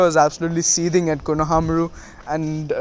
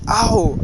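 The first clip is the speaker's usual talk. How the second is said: higher-pitched imitated "ow" of pain; mock pain cry; 5 semitones higher than usual. Someone heard shouting in pain after a thump, mock pain cry. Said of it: "shouting in pain".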